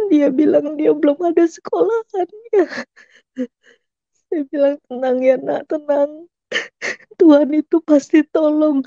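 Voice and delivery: telling it through tears she can't hold back; crying. Real, not acted. Mother recalls comforting her crying son, sobbing. Retelling son's despair, voice breaks.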